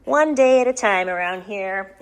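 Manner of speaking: ordinary talk, nothing marked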